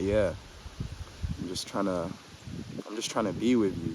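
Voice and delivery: soft, low, tender reassurance; daddy voice. Shown as a demonstration. Telling his girlfriend he wants time with her, soft daddy voice. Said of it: "like, daddy voice".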